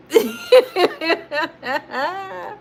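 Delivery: a high laugh that breaks almost into crying; wheezing laugh at her own mistake; helpless laugh. Real, not acted. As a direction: laugh at yourself until it squeaks.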